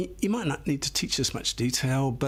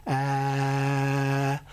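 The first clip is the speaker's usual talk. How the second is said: saying ahh. Shown as a demonstration. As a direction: open free held ah. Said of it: "It's like a free sound".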